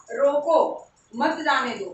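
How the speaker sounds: "रोको", clear pause, "मत जाने दो"; pause after रोको. Shown as a demonstration. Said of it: "बस कॉमा का अंतर है".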